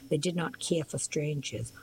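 usual speaking voice

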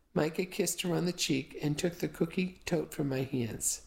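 plain reading voice

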